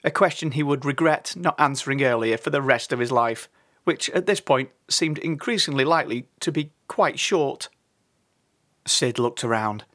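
usual speaking voice

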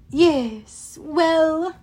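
self-important throat-clearing words; pompous announcer villain. A narrator's reading. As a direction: call the diners to attention. Villain opens his announcements.

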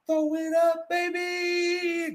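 high, drawn-out sing-out; high-pitched mock cheer; mock hype call. Mimicking a deep-pass call.

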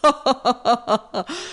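big toothy mocking laugh. Alligator laughing at the turtle, toothy laugh. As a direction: laugh with all your teeth. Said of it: "aquela risada cheia de dentes".

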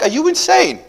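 very high-pitched mock outrage; acted aghast God; incredulous outcry. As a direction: squeak it out in disbelief. God scolding Moses in disbelief.

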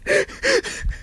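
very high wheezy giggle; helpless laughter. Real, not acted. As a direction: giggle helplessly at your own joke. Recovering from own joke.

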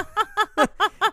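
loud high burst of laughter; real loud laughter. Real, not acted. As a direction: crack up loudly.

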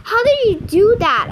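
loud, high amazed question. A narrator's reading. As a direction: ask in astonishment, voice shooting up. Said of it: "I asked her, amazed".

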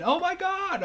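very high, drawn-out exclamation; high-pitched imitated disbelief; mock audience shock. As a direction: imitate a crowd's amused gasp.

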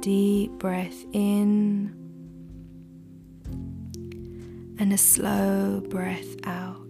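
soft whisper, close to the mic; right in your ear. Acted. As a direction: breathe the words gently into the ear.

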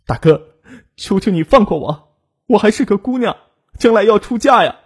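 high-pitched, desperate begging; tearful pleading. A narrator's reading. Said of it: "哭着哀求".

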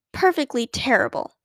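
plain reading voice

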